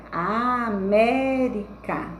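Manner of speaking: América very slow, drawn out in syllables; slow teacher's breakdown of América. Shown as a demonstration. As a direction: stretch out A-MÉ-ri-ca for counting. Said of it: "eu vou contar as três últimas".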